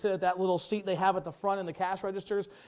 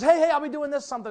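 a hint of a loud, cocky bragger, higher and louder; imitating a boastful man; 10 semitones higher, 8 dB louder, faster than usual. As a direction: toss it off like a show-off. Retold man's boast to friend.

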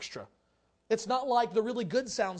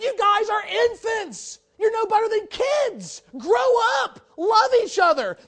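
shouted rebuke; 10 semitones higher, 10 dB louder, slower than usual. Acted. Preacher voices Paul's rebuke.

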